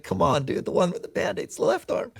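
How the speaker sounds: mock exasperated, breaking into a laugh; rising, laughing disbelief; laughing exasperation. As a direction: laugh through the obvious answer. Exasperated quote to anesthesiologist.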